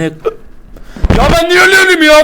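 high, loud slow indignant cry; high loud disbelief; outraged yell. Real, not acted. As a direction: protest loudly at the unfair death. Outraged after dying.

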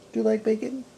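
high sing-song question to a dog; pet-talk voice. Acted. Owner coaxing the dog, cooing high.

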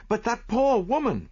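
high, concerned protest; protesting friend. A narrator's reading. Friend pleads for the deceived wife, high protest. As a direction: protest high-pitched for someone pitied.

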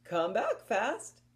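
usual speaking voice

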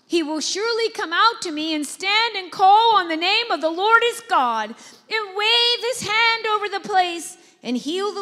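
loud, high, outraged ranting; loud indignant complaint; indignant Naaman. Acted. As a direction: fume like a proud insulted general. Naaman's angry boast voiced.

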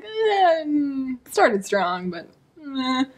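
high noncommittal "eh", sinking to indifference; half-hearted so-so verdict; lukewarm meh. Retold or imitated. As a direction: waver aloud, unconvinced. Acts out lukewarm verdict on book.

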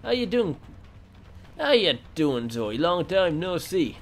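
mocking taunt. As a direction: taunt the victim sweetly. Killer taunts a survivor.

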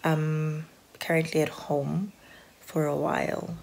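usual speaking voice

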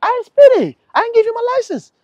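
mock outraged driver talking back to police; hammed-up defiant driver.

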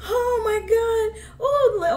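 high, slow, tearful gasp of amusement; real helpless laughter winding down; laughing to tears. Real, not acted. Reactor recovering from the comedy clip, still laughing to tears. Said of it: "I'm in tears".

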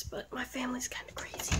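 fast secret whisper. Real, not acted. Secret aside about family.

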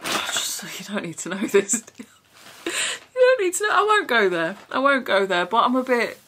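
ordinary delivery

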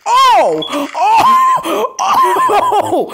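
high, loud laughing oh's; shocked laughing yelps. Real, not acted. Gamer shocked by a surprise play. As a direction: yelp in shocked delight.

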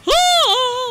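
loud, high-pitched scream; startled scream. Real, not acted. Player yelling at a crash mid-race, screaming. Sudden scream while racing.

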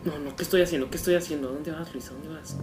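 tense hush at the character; whispering while hiding. Real, not acted. Scolding character in dark.

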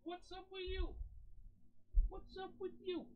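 tiny squeaky high voice, very soft. Real, not acted. Asking the cat what's up, squeaky baby talk. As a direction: coo it way up high.

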